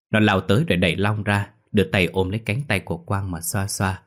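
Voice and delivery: ordinary delivery, nothing marked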